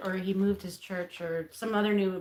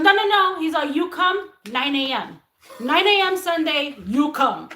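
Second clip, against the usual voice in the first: higher, louder insistent Middle Eastern man; stern Middle Eastern accent; 7 semitones higher, 8 dB louder than usual. Retold or imitated. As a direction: shut her down in a thick accent. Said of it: "he was very stern with me".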